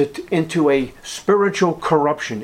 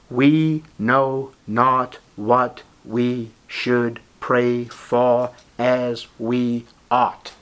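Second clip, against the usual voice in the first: very slow, each word set apart; measured, emphatic scripture reading at a crawl; 3 semitones lower, much slower than usual. Real, not acted. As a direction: read the verse deliberately, pausing after every word. Reads verse out word by word.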